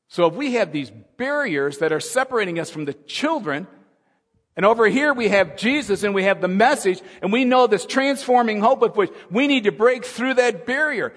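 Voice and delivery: loud, high urgent questions; preaching at full force. Acted. Preacher challenging the congregation, loud and high. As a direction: press the church to act.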